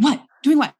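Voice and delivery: high, loud startled what; retelling her startled reply, high and loud; startled confusion. Retold or imitated. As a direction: shoot it back, baffled and caught off guard. Confused retort in retold argument.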